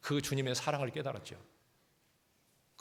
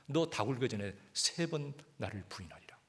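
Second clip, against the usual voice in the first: whisper; 3 dB quieter than usual. Retold or imitated. Jesus's prophecy recalled by Peter.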